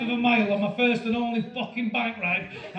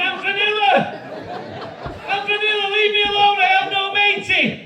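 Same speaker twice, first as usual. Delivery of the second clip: desperate shouting; 9 semitones higher than usual. Acted. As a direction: wail it out at the top of the voice. Acted cry to be left alone.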